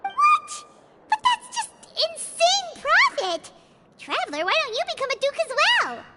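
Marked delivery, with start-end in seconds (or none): 0.0-3.5 high shocked squeal at the profit. Acted. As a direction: gasp it out, stunned by the money.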